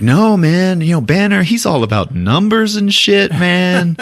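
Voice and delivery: high, pleading retold objection; mock protest. Thor reassuring Hulk about Banner, high mock protest. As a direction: push the pitch up and protest.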